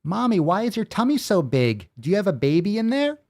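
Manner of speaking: put-on high little-kid questioning; child's voice. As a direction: ask it like a small child, pitched right up. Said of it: "read it in the voice of a child".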